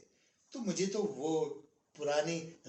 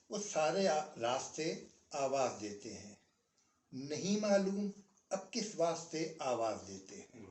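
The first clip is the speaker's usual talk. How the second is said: slow, measured nazm reading with long pauses; poem recitation; 5 semitones lower, slower than usual. Acted. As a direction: recite each line like a mushaira verse, letting the ends sink. Recites Iftikhar Arif nazm. (धीमी, ठहरी हुई नज़्म पढ़ाई)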